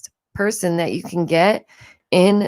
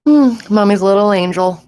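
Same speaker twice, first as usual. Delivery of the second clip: cooing; 7 dB louder than usual. Real, not acted. Cooing over her son.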